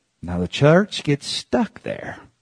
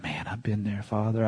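quiet confession; 3 semitones lower, 12 dB quieter than usual. Retold or imitated. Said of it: "I said".

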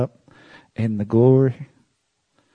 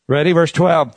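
ordinary talk, nothing marked

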